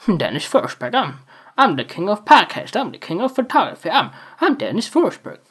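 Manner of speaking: a lightly voiced boastful announcer; grand mock-boasting with a laugh; hammed-up self-hype. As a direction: brag like a showman king, laughing through it. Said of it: "I don't talk like this all the time".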